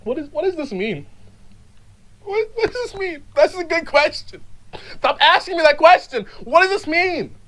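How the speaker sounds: high-pitched, worked-up puzzlement; mock-frantic, high demand for meaning; exasperated questioning. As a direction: build from hushed to insistent, staying high. Builds to exasperation at own question.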